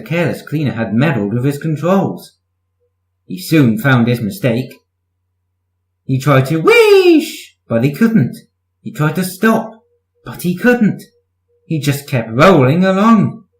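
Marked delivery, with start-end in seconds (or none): 6.0-7.6 wheesh. A narrator's reading. Narrator voices steam whoosh.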